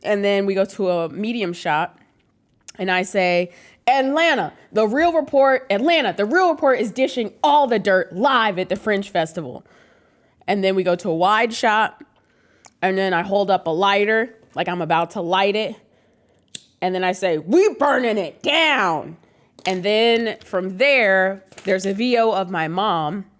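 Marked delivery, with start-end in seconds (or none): 3.9-9.6 higher, louder promo shout-out; hype announcer. Acted. 17.4-19.2 slow, defiant threat; tough defiant girl. Acted. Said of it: "like in a tough girl voice".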